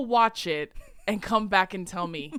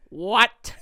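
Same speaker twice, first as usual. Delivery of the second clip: loud baffled what; old man's confused what; 7 dB louder than usual. Retold or imitated. Retelling the plane scene, a loud confused old man. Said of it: "Like that, like that exactly".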